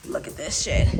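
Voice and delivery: normal talking voice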